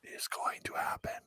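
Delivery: whispered, no voice at all. Shown as a demonstration. Repeating it in a hushed whisper to chat. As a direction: breathe it out as a secret whisper. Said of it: "but it's gonna happen".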